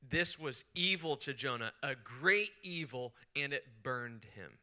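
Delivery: slow weighty reading of the Hebrew; measured, deliberate recitation; slow emphatic reading. Shown as a demonstration. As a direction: lean on each phrase slowly. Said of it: "it reads this way".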